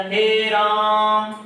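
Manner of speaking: हे राम with हे stretched long, half-sung; drawn-out हे राम. Shown as a demonstration. Teacher demonstrating a long vowel, हे stretched out. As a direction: hold हे for a long time, then राम. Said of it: "तो हे".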